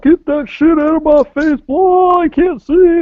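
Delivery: Hank Hill. Retold or imitated. Man in a car blinded by a flashlight, yelling like Hank Hill. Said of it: "They turned into Hank Hill".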